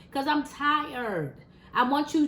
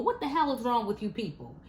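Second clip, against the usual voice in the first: normal talking voice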